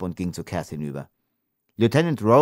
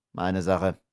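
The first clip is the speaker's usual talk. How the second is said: ordinary delivery, nothing marked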